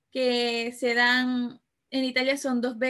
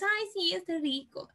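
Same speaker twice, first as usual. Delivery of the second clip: put-on higher childlike "yes, it's tasty"; little child; 6 semitones higher, 4 dB quieter than usual. Child answers the parent that the food is tasty, higher and childlike. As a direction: answer like a delighted small kid. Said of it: "Y los niños".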